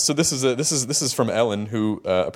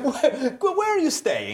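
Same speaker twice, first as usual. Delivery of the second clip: put-on Italian accent; 13 semitones higher, much slower than usual. Mocking Italian fan.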